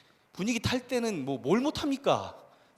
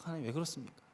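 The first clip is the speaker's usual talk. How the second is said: 하나님 왜 그렇습니까 said much softer, almost breathless; hushed plea; 2 semitones lower, 11 dB quieter, faster than usual. Retold or imitated. Pastor recalling his prayer to God, in a hushed plea.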